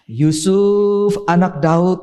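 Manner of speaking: usual speaking voice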